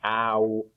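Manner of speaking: ow as in house, drawn out; ow sound. Shown as a demonstration. Teacher modelling the vowel in house, ow. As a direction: say ow slowly. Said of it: "it sounds like".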